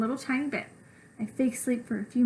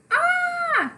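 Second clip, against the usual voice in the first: piercing high scream, louder; child's high scream; 4 dB louder than usual. Acted. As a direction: shriek again in terror. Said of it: "Stop screaming!".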